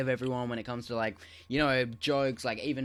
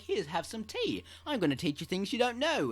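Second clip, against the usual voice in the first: lightly voiced British teacher, higher; mock British accent; 7 semitones higher, 5 dB quieter than usual. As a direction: offer tea in a British accent, playfully. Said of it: "why am I doing a British accent".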